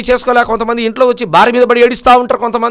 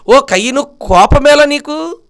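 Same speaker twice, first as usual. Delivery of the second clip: higher, very slow call of rebuke; God calling Cain to account, slow and raised; 6 semitones higher, slower than usual. Acted. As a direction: summon Cain with a drawn-out challenge.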